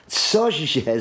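high, startled what; astonished. A narrator's reading. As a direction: gasp out Cože in disbelief. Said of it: "zvolala krysa s otevřenými ústy".

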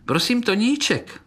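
high, piping plea; tiny beetles complaining in a squeak; thin little voices. A narrator's reading. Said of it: "žalovali tenké hlásky". (tenké prosebné hlásky)

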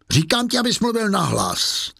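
high-pitched, exasperated order; irritated command. A narrator's reading. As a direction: insist with pitch jumping up. Javert repeats order, losing patience.

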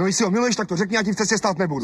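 high, fast wounded pride; huffy martyr. Acted. Husband jealous in quarrel.

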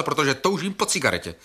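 ordinary talk, nothing marked

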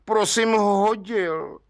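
zkroušeně, pomalu a výš; contrite admission. A narrator's reading. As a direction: own up meekly, dragging the words. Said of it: "řekl provinilec zkroušeně".

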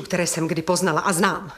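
usual speaking voice

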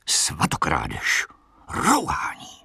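slow, menacing hiss; drawn-out, half-whispered condemnation; hissed accusation. A narrator's reading. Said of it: "zasyčel opět hlas holohlavého muže".

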